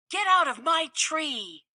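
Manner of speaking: a mother squirrel scolding an intruder, lightly voiced. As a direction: shoo them off the tree, mock cross. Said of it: "No one could scold like".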